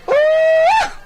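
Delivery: hammed-up startled whoop; high shouted ooh. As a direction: yelp in mock shock. Reaction to the kiss smack.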